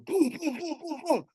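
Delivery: high-pitched "boom" door knocks; imitated knocking. Somebody knocking on the bathroom door, high imitated thuds. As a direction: mimic repeated knocks in a high voice. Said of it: "somebody started knocking on".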